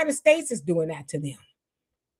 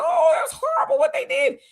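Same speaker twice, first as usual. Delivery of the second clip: mock outrage. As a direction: gasp it out like a hypocrite clutching pearls. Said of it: "they know we won't get into that".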